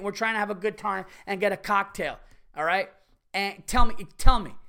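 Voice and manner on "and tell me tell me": louder, insistent repeat; demanding. Retold or imitated. Customer demanding the manager explain, insistent. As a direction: press him for an answer.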